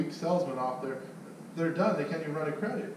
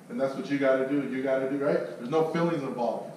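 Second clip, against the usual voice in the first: ordinary talk, nothing marked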